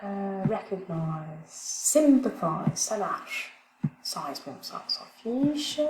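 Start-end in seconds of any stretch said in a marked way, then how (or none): 0.4-2.7 "recognize" and "sympathize" slowly, stress on the first syllable; drawn out, leaning on the first syllable; slow stress demo. Shown as a demonstration. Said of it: "l'accent sur la première syllabe". ("recognize", "sympathize" lentement, accent sur la première syllabe)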